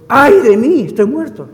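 loud, high cry of dread; sudden loud wail, then easing slightly; alarmed outcry. Acted. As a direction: cry out as if struck by doom. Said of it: "la primera reacción fue".

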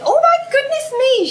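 high, loud gasp of recognition; mock a stranger's delighted surprise; surprised lady. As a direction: fan lady suddenly recognising the actress, surprised. Lady recognises her, voiced.

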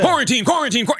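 mock announcer. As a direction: imitate a hyped radio host. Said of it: "that's how these guys sound".

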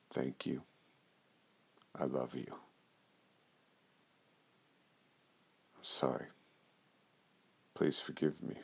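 quiet devotional chant; prayer recitation. Acted. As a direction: murmur each phrase as a heartfelt prayer.

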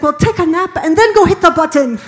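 high, loud laughing order; exasperated shout. Acted. Cartoon Frenchman answering the tired girl, exasperated laughing shout. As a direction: yell it as an impatient cartoon captain, laughing. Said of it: "He's like".

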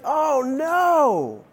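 a hammed-up wail of dismay; theatrical despair at rejection; mock dismay. Acted dismay at being too old.

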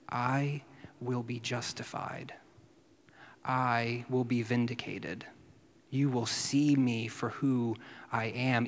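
slow and soft, firm; a hushed, certain promise; slow quiet vow. Retold or imitated. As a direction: vow it slowly under the breath. Jesus vowing vindication to Caiaphas.

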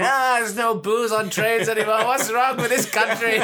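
hammed-up drunk grumble, laughing; drunk woman. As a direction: lament like a tipsy old boozer.

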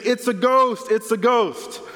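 high-pitched imitation of panicked screaming; retold terrified cry. Disciples seeing a figure on the water, retold terrified cry. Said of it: "start screaming".

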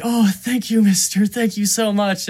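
high, loud imitated gratitude; effusive thanks. Kidnapped kid to his rescuer, effusively grateful.

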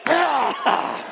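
loud, high comic outburst; mock swatting yell. Preacher acting out his wife swatting the mosquito, mock yell. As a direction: burst out as if attacking a bug.

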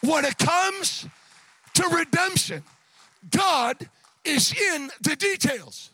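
loud, emphatic sermon climax; impassioned preaching. Real, not acted. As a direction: drive each phrase home to the congregation. Preaches at full force.

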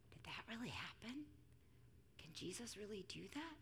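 very soft, wondering questions; quiet childlike curiosity; hushed wonder. Retold or imitated. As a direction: imitate an awed child, nearly whispering. Daughter's awed questions after the story.

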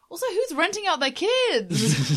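high, loud laughing question. Real, not acted. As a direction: laugh through it in disbelief. Laughing disbelief.

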